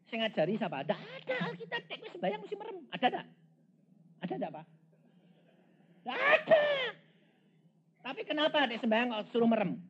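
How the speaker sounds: high, loud preacher's challenge, building to a shout; preaching at full force, shouting the answer; fiery preaching. Acted. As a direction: press the congregation hard.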